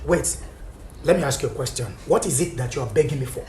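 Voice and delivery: low, soft, controlled questioning; hushed confrontation. Real, not acted. Husband questioning his pleading wife, hushed confrontation. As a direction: drop to a quiet, pointed question.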